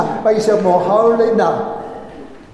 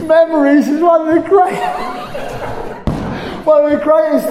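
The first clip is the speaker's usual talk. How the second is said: laughing; 6 semitones higher, faster than usual. Real, not acted. Preacher laughing at younger listeners.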